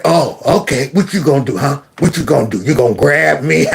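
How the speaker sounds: taunting dare. Retold or imitated. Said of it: "He said".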